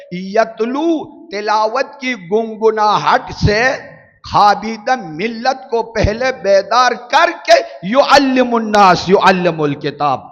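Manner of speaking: high, loud, slow sermon climax; preacher calls out to the congregation, loud and slow; rousing preaching. Acted. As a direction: wake the sleeping crowd with your voice. Preaching at full force.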